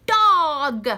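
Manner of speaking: loud burst on Dog; excited reveal. Shown as a demonstration. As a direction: announce the word with a pop. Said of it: "Duh, duh, duh".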